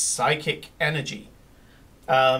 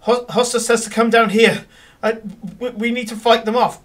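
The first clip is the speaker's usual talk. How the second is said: relayed call, louder and higher; urgent message; 6 semitones higher, 5 dB louder than usual. Acted. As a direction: deliver a battle summons. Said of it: "the robed figure does the message thing and says".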